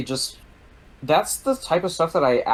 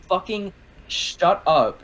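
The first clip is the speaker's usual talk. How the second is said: slow, higher mock snap at a teammate; retold yell; 7 semitones higher, slower than usual. Speaker snapping at a screaming teammate mid-game, mock angry yell. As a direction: bark it slowly to shut her up. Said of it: "I just yell at A G B".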